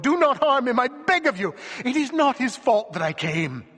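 high, loud begging; imploring plea. A narrator's reading. Wife begging the dwarf for mercy, high and loud. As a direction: beg him to have mercy. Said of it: "Jean-Marie implored".

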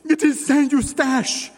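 high, loud cry of terror; panicked scream. A narrator's reading. Wife hears the dwarf coming, panicked high cry. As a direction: shriek it as the danger arrives. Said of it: "Jean-Marie cried".

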